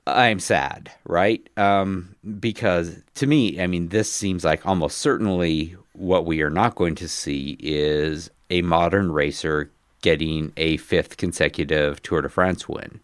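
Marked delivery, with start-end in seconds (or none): none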